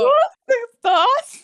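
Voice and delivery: high, loud, overwhelmed "nossa"; emotional exclamation. Retold or imitated. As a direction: gasp it out, deeply moved by the scene. Moved reaction to the episode. ("nossa" alto e emocionado)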